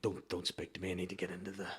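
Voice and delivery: hushed, low imitation of a precious actor; mock actor intensity. Diva actor getting into the zone, hushed mock intensity. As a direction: half-whisper it, shutting everyone out. Mimicking self-serious actors on set.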